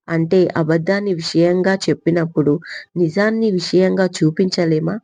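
ordinary delivery, nothing marked

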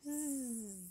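soft buzzing bzzz; bee buzz. A narrator's reading. Bees in the flowers, soft buzz imitation.

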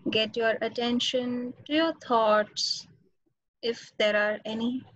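normal talking voice

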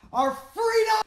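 shout. Acted. Defiant battle cry speech.

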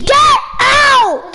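loud, exasperated shout at a sibling; forceful order to leave; real yelling. Real, not acted. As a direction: yell it, totally fed up. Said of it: "Because you're yelling".

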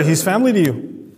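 ordinary talk

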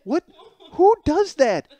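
high, loud outraged disbelief; incredulous. Retold or imitated. Husband staring at his wife, incredulous. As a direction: exclaim it as comic horror. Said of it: "this weird, like, face, like".

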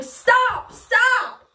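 high mock scream; imitating own screaming. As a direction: cry out high as if in pain. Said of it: "He hears me screaming".

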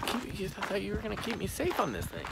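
high, soft put-on voice; mock-wounded complaint. Acting the let-down rider on the trail, high soft complaint. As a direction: plead softly in a high voice.

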